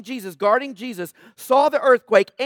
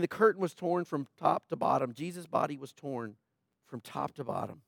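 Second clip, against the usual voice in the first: much softer, hushed and solemn; 8 semitones lower, 12 dB quieter than usual. Real, not acted. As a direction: lower the voice to a reverent hush. Preacher describing the torn curtain.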